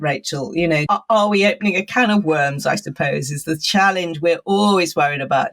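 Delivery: ordinary delivery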